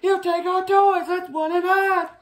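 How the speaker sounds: put-on high, scared children's cry; high frightened cry. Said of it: "they cried".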